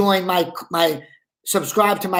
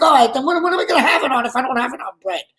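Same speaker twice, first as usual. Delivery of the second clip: whiny complaint; 6 semitones higher, faster than usual. Retold or imitated. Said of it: "And he's like".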